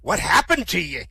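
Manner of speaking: lightly voiced pirate asking in surprise; Morty. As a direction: ask it with a hint of pirate. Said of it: "Two pirates, Morty and Sol".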